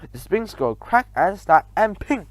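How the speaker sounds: plain reading voice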